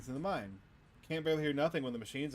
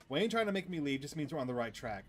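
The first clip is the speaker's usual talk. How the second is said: ordinary talk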